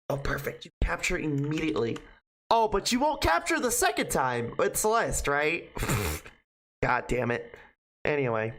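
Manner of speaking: ordinary talk, nothing marked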